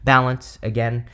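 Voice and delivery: usual speaking voice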